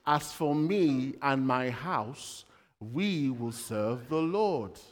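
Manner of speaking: slow, weighty declaration; solemn vow. Retold or imitated. Joshua vowing his house will serve the Lord slowly. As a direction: lay each word down slowly and firmly.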